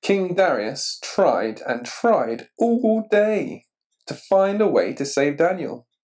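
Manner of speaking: ordinary delivery, nothing marked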